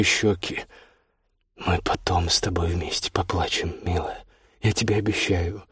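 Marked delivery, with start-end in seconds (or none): 1.6-5.6 low, hushed, near-whispered reassurance; tender. A narrator's reading. Kostya comforts crying Olya in the forest, tenderly. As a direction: soothe gently in a soft, low voice. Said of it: "прозвучали так непривычно ласково".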